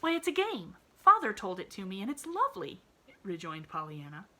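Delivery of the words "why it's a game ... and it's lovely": put-on bright, high little girl voice; eager child reassuring her friend; cheerful little girl. A narrator's reading. Pollyanna voiced in story.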